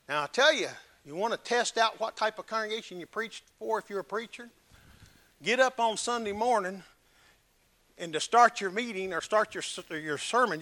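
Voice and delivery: ordinary delivery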